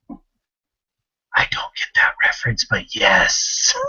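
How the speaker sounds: slow, whispered and dry; mock deadpan hush. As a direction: whisper it, totally straight-faced.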